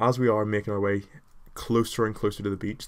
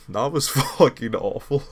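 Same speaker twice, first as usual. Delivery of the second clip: laughing; 4 semitones higher, 5 dB louder than usual. Real, not acted. Laughing at own bad accent.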